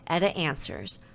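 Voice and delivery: plain reading voice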